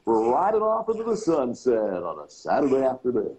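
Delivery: slow, drawn-out, swooping cheesy DJ patter; cheesy radio DJ. Shown as a demonstration. Dad showing his daughter his old air-check voice, cheesy radio DJ. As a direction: croon the station break like an old-time DJ. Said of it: "Was that your voice that you used?".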